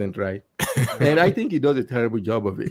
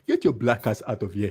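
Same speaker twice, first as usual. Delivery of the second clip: lower, hammed-up Nigerian accent; exaggerated Nigerian accent; 4 semitones lower, 4 dB quieter than usual. Making his friends laugh, exaggerated Nigerian accent. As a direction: ham up your own Nigerian accent. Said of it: "I'm exaggerating it".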